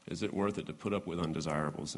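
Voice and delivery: soft, fast rhetorical echo. Shown as a demonstration. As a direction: echo the question softly, quickly. Question repeated.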